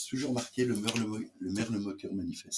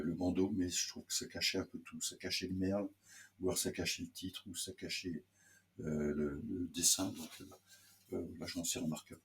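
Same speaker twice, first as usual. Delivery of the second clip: normal talking voice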